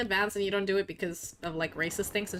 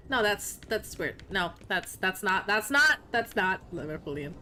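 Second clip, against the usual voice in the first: ordinary delivery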